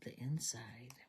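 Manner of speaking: soft unvoiced whisper; a little searching whisper; whisper to self. Real, not acted. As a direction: breathe it while hunting for it.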